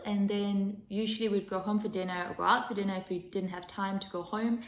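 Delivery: ordinary talk, nothing marked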